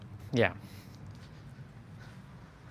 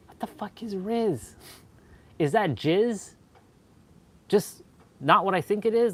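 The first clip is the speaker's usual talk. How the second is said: high, hushed mock bewilderment; baffled disbelief; 6 semitones higher, 3 dB quieter than usual. On first hearing "Riz", baffled. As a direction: ask it high and incredulous, acting puzzled. Retold shock at word Riz.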